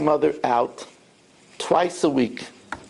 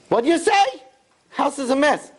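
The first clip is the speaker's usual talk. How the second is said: mocking echo; 10 semitones higher than usual. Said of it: "she, she says".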